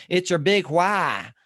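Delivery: slow, emphatic Gary Keller accent impression; Gary Keller's accent. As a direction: mimic Gary Keller's accent, stretching each word. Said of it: "in his accent".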